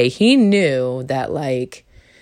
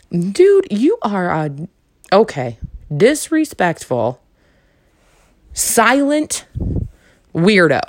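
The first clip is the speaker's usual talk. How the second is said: high, loud outraged jab at the date; exasperated rant; 6 semitones higher, 3 dB louder, slower than usual. Retold or imitated. Retelling the rude date, outraged and loud. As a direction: spit out the insult in disbelief.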